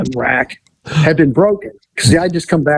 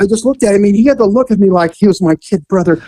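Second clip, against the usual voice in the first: ordinary talk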